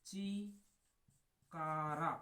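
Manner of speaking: चिकारा stretched very slowly; drawn-out name. Shown as a demonstration. Teacher modelling चिकारा for students, very slowly stretched. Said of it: "ची से बन जाएगा चिकारा".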